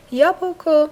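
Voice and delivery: یا بوکو called out high and loud; emphatic model. Shown as a demonstration. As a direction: project یا بوکو for the class. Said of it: "modeling word emphatically". (یا بوکو با صدای بلند و زیر)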